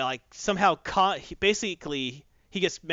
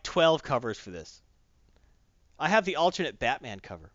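usual speaking voice

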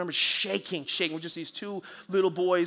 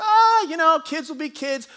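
high, loud breezy "ah", then easing; permissive; 9 semitones higher, 8 dB louder than usual. Acted. Elder imagined letting the boy go, breezily permissive. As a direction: wave it off in a high-pitched, easygoing voice. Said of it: "the permissive side".

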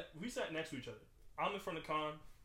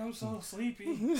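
mock whimper; 5 semitones higher, much slower than usual. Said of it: "I was like".

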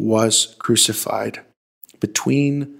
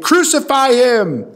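high, loud crowd cry; crowd shout; 13 semitones higher, 5 dB louder than usual. A narrator's reading. Crowd demands a sentence from Pilate, shouted. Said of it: "The crowd shouted".

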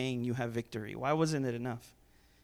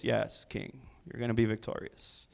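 sarcasm; 6 semitones lower, 6 dB quieter than usual. Shown as a demonstration. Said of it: "This is how I do sarcasm".

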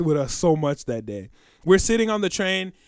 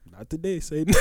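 quiet dismissal; 3 semitones lower, 8 dB quieter than usual. Retold or imitated. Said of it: "I was".

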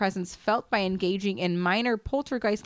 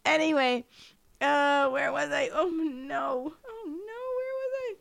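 laughing; 6 semitones higher than usual. Real, not acted. Recovering from laughing fit.

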